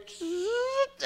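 high buzzing zip noise; vocal zap, very high; imitated zap. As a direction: make a sound effect for a hand seizing up. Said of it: "his hand goes".

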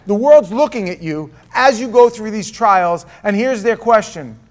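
loud, fast, fervent sermon delivery; impassioned preaching. Acted. Preacher presses the congregation about trials, loud and fervent. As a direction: drive the point home to the room.

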